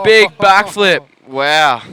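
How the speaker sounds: high, loud, slow amazed call; breathless sideline reporter. Real, not acted. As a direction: cry out the big trick. Sideline reporter calls the backflip.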